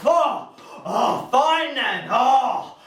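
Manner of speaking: high, loud, huffy groans; mock huffy disappointment. Refused lady heckler giving up on the comic, mock huff. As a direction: give in with exaggerated disappointment.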